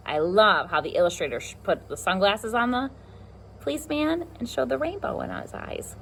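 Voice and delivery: plain reading voice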